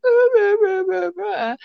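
high wailing sob, words half lost in crying; loud, high blubbering whine of a terrified wife; crying Wendy voice. Retold or imitated. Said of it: "that's my crying Wendy voice".

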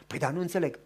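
low, soft doubting voice; a hint of a skeptic muttering; doubting listener. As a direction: skeptical church member, softly. Objector voiced low and quiet.

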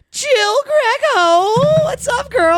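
high, loud, drawn-out announcer build; hyped intro. Real, not acted. Scene: podcast host bringing in the guest, high loud hype. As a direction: sell the guest's name big.

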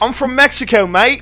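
high, loud Mexican-Aussie muddle; botched Mexican accent. Pretend. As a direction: attempt Mexican and land in mock Australian. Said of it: "you have a crazy Spanish accent".

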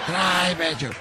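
half-playful bossy shout; mock yell like he heard it. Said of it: "así como me escucha". (grito fingido de la presidenta)

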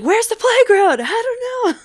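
high mock-frantic exchange, starting loud; imitated panic. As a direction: act out a frantic back-and-forth in a high voice. Co-host acts out panicked exchange.

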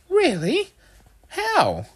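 very high mother voice; questioning mum. A narrator's reading.

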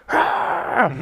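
high mock dinosaur screech; T-Rex yell. Comic imitation of the sergeant's yell, high screech. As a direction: imitate an instructor yelling like a T-Rex. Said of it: "whenever he would yell, he reminded me of the T-Rex".

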